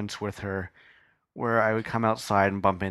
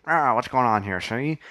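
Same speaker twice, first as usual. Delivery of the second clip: imitated Dick Tracy mobster; punchy old-time gangster impersonation; twenties gangster; 2 semitones higher, 3 dB louder than usual. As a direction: do a hammy movie gangster. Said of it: "He sounded like a nineteen twenty gangster from Dick Tracy".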